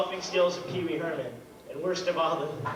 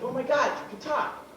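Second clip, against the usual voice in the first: amazed; 7 semitones higher than usual. Retold or imitated.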